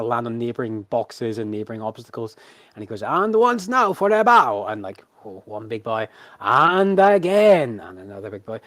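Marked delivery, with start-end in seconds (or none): 3.0-4.7 high, loud circus announcer; ringmaster's cue. Acted. Ringmaster cues his animals to bow, grand showman call. 6.4-7.8 high, loud, very drawn-out call; ringmaster's cue. Acted. Ringmaster cues another bow, long theatrical call.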